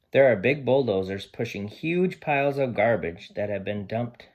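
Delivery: lively picture-book read-aloud; reading to children. Acted. Teacher reading Sam the dog's story to her class, lively and expressive.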